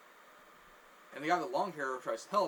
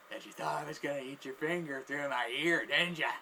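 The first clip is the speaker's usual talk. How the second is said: teasing gloat; 4 dB louder than usual. Retold or imitated. Homeless man teasing his victim after the prank, gloating. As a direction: taunt breaking into a loud cackle.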